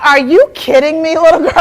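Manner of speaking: loud, high outraged mom; the ego blowing up at her kid; mock outrage, loud and high; indignant ego. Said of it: "My ego is like".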